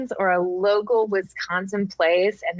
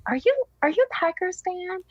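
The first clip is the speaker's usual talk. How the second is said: eager question; 10 semitones higher than usual. Retold or imitated. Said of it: "I just went and said".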